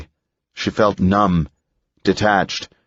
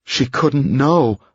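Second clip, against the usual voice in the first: horrified thought; 7 semitones higher, slower than usual. A narrator's reading. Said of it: "thought Edith, horrified".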